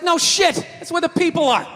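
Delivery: exasperated retort. Retold or imitated.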